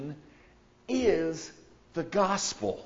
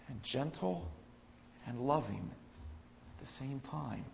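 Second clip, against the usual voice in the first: softening to gentle; 10 dB quieter than usual. Acted. Contrast with power, softening.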